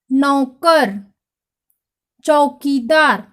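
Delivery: each word read slowly with long pauses; slow, clear word-by-word dictation; reading a word list. Shown as a demonstration. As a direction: read the words out for children to repeat. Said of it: "ओ की मात्रा के शब्दों पर ध्यान दीजिए".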